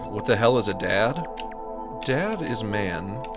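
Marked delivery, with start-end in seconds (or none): none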